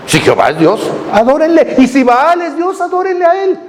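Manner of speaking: high-pitched, declamatory ultimatum; prophet's challenge. Retold or imitated. Preacher voices prophet confronting a nation, high challenging call.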